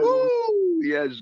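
high, loud praise whoop; joyful whoop. Real, not acted. Pastor whooping in praise to God. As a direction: let out a holy whoop. Ecstatic cry.

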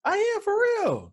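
loud falsetto protest, stretched slow; high-pitched voice. Real, not acted. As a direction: squeal it out high and loud, insisting. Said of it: "when your voice go up that high".

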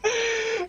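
high-pitched hysterical laugh; hysterical laughter. Real, not acted. Reacting to the finished run, hysterical laughter. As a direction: burst into high, helpless laughing.

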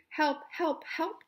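high-pitched mock cry for help. A narrator's reading. Little blue truck stuck in mud, high cry for help. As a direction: call out in mild storybook distress. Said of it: "cried the little blue truck".

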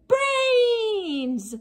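zombie cry. Acted. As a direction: cry out high like a zombie rallying the horde. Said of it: "cried Reginald".

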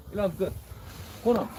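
ordinary talk

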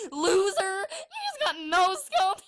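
shrieking, taunting laughter; mock them while laughing hard; angry crying. Real, not acted.

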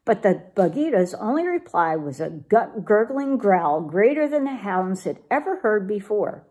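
usual speaking voice

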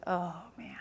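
breathy whispered "oh man"; retelling a client's request, nervous whisper; whispered dread. Retold or imitated. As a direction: whisper it like you're in trouble. Retold dread at private talk.

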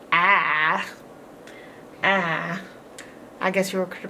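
ordinary talk

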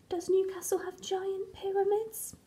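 whispered aside to himself; a hushed, unsure aside; doubtful whisper. Acted. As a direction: softly question your own claim. Crayon doubts itself in an aside.

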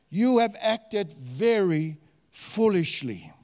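"very foolishly" slow, with weighty spacing; emphatic reading. Acted. Laban belittling Jacob as a fool, slow emphatic reading. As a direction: spell out "very foolishly" word by word. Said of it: "gonna emphasize some of the words".

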